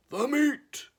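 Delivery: loud, very high crew roar. Acted. Crew roars for meat at dinner, loud roar. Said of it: "we all roared".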